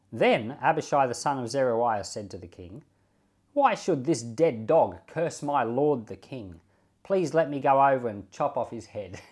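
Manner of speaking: measured, formal Bible reading; a pastor reading the chapter aloud to his flock, steady and formal; scripture reading. Acted. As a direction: read the passage aloud to the congregation. Reads the scripture passage aloud.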